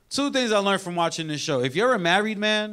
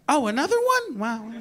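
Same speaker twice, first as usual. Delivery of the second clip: put-on judgy girlfriend; 6 semitones higher than usual. Girlfriend judging his smoking.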